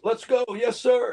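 high, clipped "yes sir" parody of a movie soldier; quick high military response; mock soldier reply. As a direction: bland stock soldier shouting orders back.

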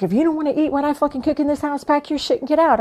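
high, fast, angry mock snap; mother snapping. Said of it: "She snapped on me".

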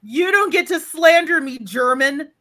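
high, loud, hammed-up indignant rant; theatrical fury, voice cracking at the end; mock outrage. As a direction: play the wounded Texan to the hilt. Outraged Texan defending herself.

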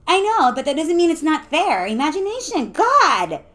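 high, loud, insistent; exasperated protest. A narrator's reading. Lucia defending her imagination to friend, exasperated. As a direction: build to a loud, fed-up "God".

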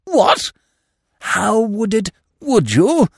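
high, startled questions; taken aback; astonished. A narrator's reading. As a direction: stammer it out pitched high in amazement. Uncle astonished by the offer.

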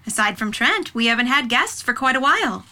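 ordinary talk

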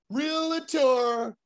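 high, drawn-out singsong; slow high-pitched sales call; entrance call. Retold or imitated. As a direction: announce yourself with long, held vowels. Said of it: "I walk in and I'll go".

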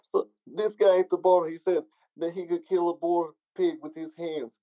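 mock Serbian accent, telling a bar story. Serbian man at the bar boasting, in mock Serbian accent. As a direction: play a Serbian man bragging. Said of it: "do, like, an accent of".

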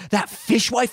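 high, scandalised dad asking; lightly voiced huffy dad. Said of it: "he says in a dad voice".

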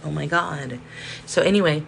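ordinary delivery

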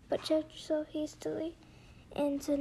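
plain reading voice

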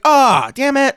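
mock a frustrated dog owner; high, annoyed groan of words; exasperated. Acting out a bad owner.

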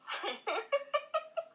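rising burst of laughter; high laugh. Real, not acted. (risa aguda)

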